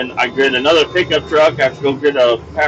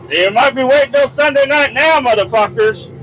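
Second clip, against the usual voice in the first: mock outrage; 11 semitones higher than usual. Real, not acted. Angry aside at work.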